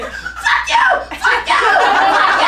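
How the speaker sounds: shrieking high and loud; high, laughing repeated shriek; retold screaming. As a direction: scream it like a panicked alarm. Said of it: "I kept saying".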